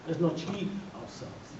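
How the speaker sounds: normal talking voice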